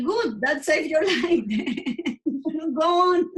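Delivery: retold mom's reply, laughing and close to tears; laughing relief.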